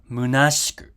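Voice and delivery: "Munashiku" with no vowel between sh and k; unvoiced sh. Shown as a demonstration. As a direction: say "Munashiku" as moo-NAHSH-koo. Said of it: "this sh here is also unvoiced".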